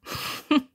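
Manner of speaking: short high breathy scoff of a laugh; a disbelieving little laugh, barely voiced. Hero scoffs at rival claiming no murder intent, breathy laugh. Bitter laugh at villain's denial.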